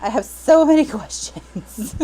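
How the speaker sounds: laughs mid-line; amused exasperation; laughing. Real, not acted. Reader laughing at the plot holes, exasperated.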